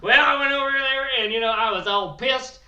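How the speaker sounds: rowdy hillbilly Bill bragging about his wedding news. Acted. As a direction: play the brash trailer-park groom, pushed up and loud. Character Bill Morgan holds voice.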